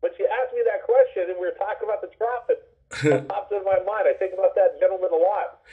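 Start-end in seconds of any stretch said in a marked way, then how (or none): 0.0-4.1 laughing it off, voice pitched up; embarrassed laugh. Real, not acted. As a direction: laugh away the embarrassment of getting emotional. Laughing off his emotion.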